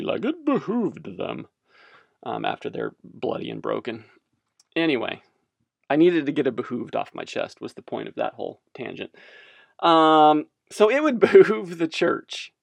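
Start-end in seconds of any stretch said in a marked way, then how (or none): 0.2-1.5 slow, lightly put-on arrogant British lord; arrogant UK accent. Pompous medieval lord over his serfs, mild British accent. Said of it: "arrogance in his U K accent". 9.8-12.5 laughing. Real, not acted. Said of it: "I need to stop with that word".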